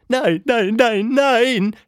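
high, loud string of frantic cries; calling out. A narrator's reading. Miss Anita frantically swiping at the phone, calling out. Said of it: "rief sie".

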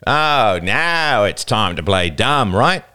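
put-on older boy sneering at the frightened girls. A narrator's reading. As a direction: sneer at her for playing dumb. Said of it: "The older boy sneered".

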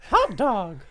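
very high, loud squeaky cartoon mouse voice; gleeful falsetto cartoon mouse, laughing; Mickey Mouse. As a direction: crank the pitch way up like Mickey. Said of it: "Mickey Mouse".

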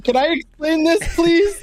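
high whiny plea; mock pleading. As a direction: beg like an eager kid. Pleading to explain.

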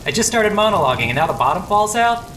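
real annoyance, breaking character; exasperated. Real, not acted. Narrator caught in the rain, real exasperation. Rain interrupts his monologue.

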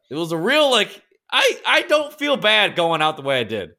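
excited. Real, not acted. Excited recounting peaceful death.

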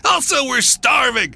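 high-pitched complaining shout; exasperated shout. A narrator's reading. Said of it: "one of them shouted".

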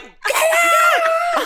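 very high screamed order; furious scream. Retold or imitated. Riley ordering men out with a knife, screamed. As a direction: shriek it to clear the room.

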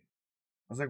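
usual speaking voice

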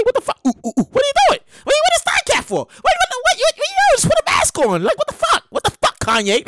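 high, baffled squeals of disbelief. Real, not acted. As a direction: shriek like you can't believe your eyes. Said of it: "which I don't even understand".

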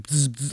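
buzzing like a trapped bumblebee; short, repeated bzz noises; imitated bee buzz. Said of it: "like, it was super loud".

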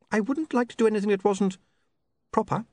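put-on high, sharp young woman's voice; an offended, prim young lady; indignant young woman. Sacharissa prim.

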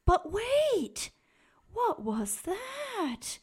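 high, startled cry of but wait; sudden alarm. A narrator's reading. Narrator stops the tale short, startled. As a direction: jump in with a startled high call.

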